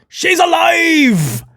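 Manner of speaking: high, slow, shouted: she's alive; mock astonished shout. Vlogger mock-celebrating a forgotten wrestler's return, shouting. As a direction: gasp it out like a miracle.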